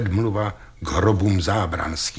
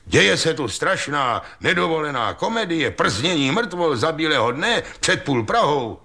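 indignant complaint; 7 semitones higher than usual. A narrator's reading. Ostrohradský denounces scandal to police.

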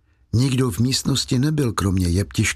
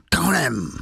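higher, slurred outburst on the words; wild animal cry; 4 semitones higher than usual. A narrator's reading. Inspector barks at the fugitive, wild animal-like urgency. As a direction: run the words together in a fierce burst. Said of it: "Nebylo to lidské slovo, byl to zvířecí zvuk".

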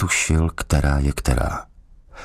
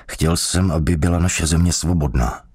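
ordinary delivery, nothing marked

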